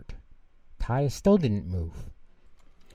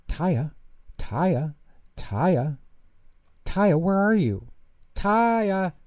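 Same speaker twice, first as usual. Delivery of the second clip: high, loud calls growing more urgent; a dad hunting for his lost child; calling out; 9 semitones higher, 5 dB louder, much slower than usual. Acted. Father searching store for daughter.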